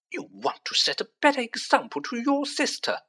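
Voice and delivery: snapping rebuke. A narrator's reading. Mrs Weasley scolding the twins about their sister, snapping. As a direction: snap at the boys like a cross mother. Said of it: "snapped Missus Weasley".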